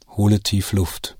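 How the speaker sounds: ordinary talk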